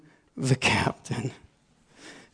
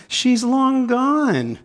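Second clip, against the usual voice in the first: drawn-out excuse; 8 semitones higher, much slower than usual. Retold or imitated. Excuse to inner voice.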